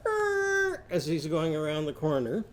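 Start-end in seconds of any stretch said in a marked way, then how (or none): 0.1-0.8 very high sustained "errr"; scooter screech "er". Shown as a demonstration. Teacher imitates Irving's scooter turning, high squealed "er". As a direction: squeal a long, level high "er". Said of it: "and it goes".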